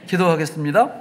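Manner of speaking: ordinary talk, nothing marked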